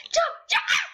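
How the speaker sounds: high, laughing jump calls; excited jump. Real, not acted. Playing the game, urging the jump with laughter. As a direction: squeal it as the jump happens.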